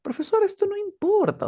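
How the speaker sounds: high mock-student complaint; objecting student. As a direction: put on a high voice protesting to the teacher.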